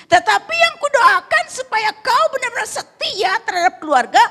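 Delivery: loud, high-pitched nagging tirade; scolding wife. Acted. Wife scolding a husband who never comes home, shrieked rebuke.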